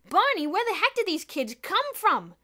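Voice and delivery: high, loud panicked policeman; desperate shout. A narrator's reading. Policeman to partner Barney, shouting desperately. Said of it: "A desperate-looking policeman shouted".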